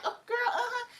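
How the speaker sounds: high, sugary and laughing; mock high fake-friendly voice. Hazel sweet-talking the waitress, high and giggly. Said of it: "turn to her and be like".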